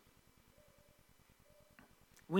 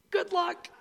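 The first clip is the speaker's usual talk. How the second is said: high-pitched, offhand mock send-off; mock well-wishing; 10 semitones higher, 3 dB quieter than usual. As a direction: toss it off high, imitating an unhelpful well-wisher. Mocking what Jesus didn't say.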